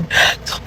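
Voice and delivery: quick unvoiced gasp of alarm; sharp gasp. Real, not acted. Counting months to December, a sudden alarmed gasp. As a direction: suck in air as it hits you.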